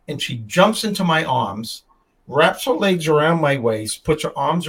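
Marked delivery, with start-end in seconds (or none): none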